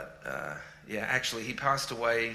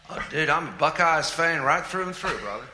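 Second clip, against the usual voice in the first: louder, higher put-on American accent; mock American accent; 6 semitones higher, 8 dB louder than usual. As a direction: ham up a fake American twang. Jokes he sounds local, teased about accent.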